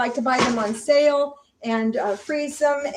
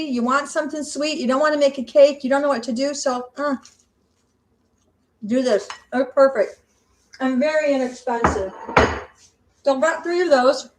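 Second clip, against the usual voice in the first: ordinary delivery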